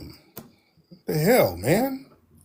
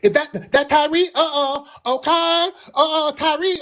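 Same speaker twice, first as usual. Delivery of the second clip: high, sassy imitation of a challenger; whiny put-on voice, pitched way up; mock sassy; 11 semitones higher than usual. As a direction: mimic an indignant sassy man. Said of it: "and he was all sassy".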